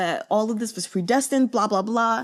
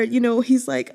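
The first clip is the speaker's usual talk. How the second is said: ordinary delivery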